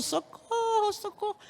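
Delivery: high, fading cries of socorro; screams for help. Retold or imitated. Woman telling church of hell, imitating burning people's cries. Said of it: "gritando, gritando".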